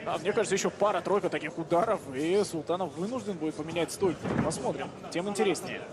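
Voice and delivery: ordinary talk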